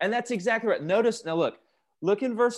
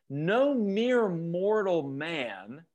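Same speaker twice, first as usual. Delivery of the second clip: slow, deliberate: No mere mortal man; stress on man; much slower than usual. Shown as a demonstration. Teacher slowly stressing man for the class. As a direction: draw out man with weight. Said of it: "emphasis on the word man".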